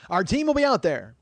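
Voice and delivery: high, loud, booming promise; mock customer-service enthusiasm; chirpy company agent. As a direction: promise it like an overeager dispatcher.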